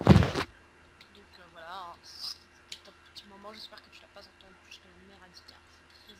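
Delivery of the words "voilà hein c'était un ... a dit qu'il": very quiet. Real, not acted. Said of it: "je t'entends tout bas".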